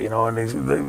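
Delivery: ordinary delivery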